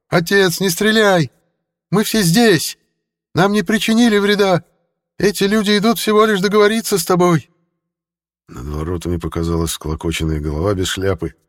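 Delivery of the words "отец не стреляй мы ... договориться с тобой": much higher, louder desperate yell. A narrator's reading. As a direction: scream across to his father. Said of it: "выбежал вперёд и заорал". (отчаянный крик)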